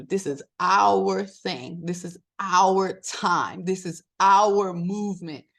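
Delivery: slow, each "our" leaned on hard; rallying emphasis. Real, not acted. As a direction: drag it out, punching "our" every line. Rallying listeners to her movement.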